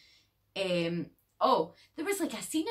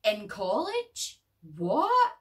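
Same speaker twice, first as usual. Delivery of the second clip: shocked disbelief; 4 semitones higher, 4 dB louder, much slower than usual. Retold or imitated. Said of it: "she's like".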